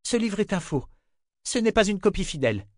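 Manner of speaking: high, insistent character voice; urgent captive woman. Acted. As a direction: pitch it up and assert it firmly. Captive urgently insists book is fake.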